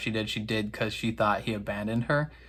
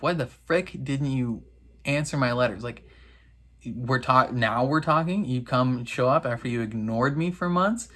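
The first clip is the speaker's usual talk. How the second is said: normal talking voice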